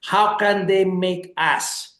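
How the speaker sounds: ordinary talk, nothing marked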